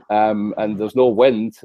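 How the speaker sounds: ordinary talk, nothing marked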